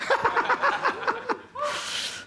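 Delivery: a high, delighted laugh; real laugh. Real, not acted. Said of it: "I don't know what's going on here".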